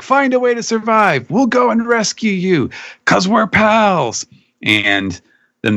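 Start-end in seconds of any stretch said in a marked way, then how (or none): 0.0-4.3 calling back. Retold or imitated. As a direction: call across to a friend far away. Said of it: "calls back".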